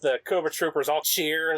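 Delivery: ordinary talk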